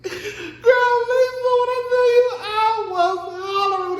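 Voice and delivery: high, laughing through the words; laughing hard. Real, not acted. Retelling the yodel scene, laughing hard. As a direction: crack up while talking.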